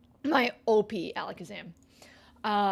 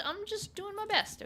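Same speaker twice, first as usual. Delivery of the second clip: high, whiny plea; pitched-up mock whine; whiny pleading; 10 semitones higher, 4 dB quieter than usual. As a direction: beg for some slack, squeaky. Defensive outburst at chat.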